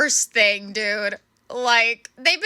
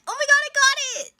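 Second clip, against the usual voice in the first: high, fast, giddy imitation; excited; faster than usual. Imitating the crewman bursting in, excited and fast. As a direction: mimic his breathless glee. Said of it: "He came in here all excited".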